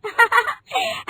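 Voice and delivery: high rising giggle; quick bright giggle; bride's happy giggle. Acted. Rinki giggles.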